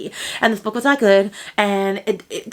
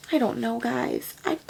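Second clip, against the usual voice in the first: much softer, slow and sighed; sighing resignation; 2 semitones higher, 9 dB quieter than usual. Real, not acted. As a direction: let the breath out, defeated.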